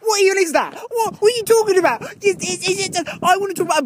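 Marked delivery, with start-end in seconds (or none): none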